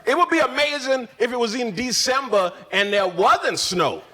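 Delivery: ordinary talk, nothing marked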